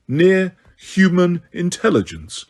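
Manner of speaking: slow, weighty echo of "Near-human intelligence"; slow repeat. A narrator's reading. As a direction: drag out "Near-human intelligence" with offended scorn. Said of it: "repeated Megorian".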